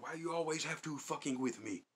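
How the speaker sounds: high, loud imitation of the Yemeni clerk's accent. Clerk snapping at troublemakers, loud high accented impression. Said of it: "He'd be like".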